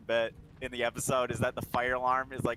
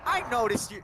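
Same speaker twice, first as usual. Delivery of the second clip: higher and louder, quick protest; 10 semitones higher, 5 dB louder than usual. Retold or imitated. Character protests to his partner, high and loud. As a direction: blurt it defensively. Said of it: "And he's like".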